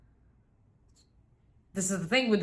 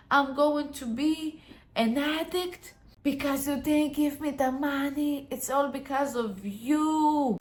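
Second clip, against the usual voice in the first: higher, wounded wailing blame; dramatic victim; 5 semitones higher than usual. Acted. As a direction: overplay the tragedy to guilt them. Family member refused money.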